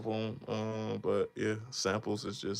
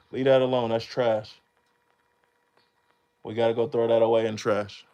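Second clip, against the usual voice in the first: louder firm call-out; telling off; 6 dB louder than usual. Real, not acted. Warning his kid off the trash, loud call.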